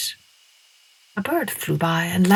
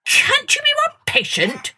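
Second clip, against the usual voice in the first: much higher, angry crow; angry rooster. A narrator's reading. Peter Cockerel angrily scolding Masha, high. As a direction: snap at her impatiently. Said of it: "He sounded really angry".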